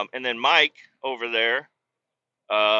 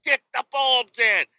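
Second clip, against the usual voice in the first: frustrated yell; 9 semitones higher, 4 dB louder than usual. Retold or imitated. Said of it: "I'm just like".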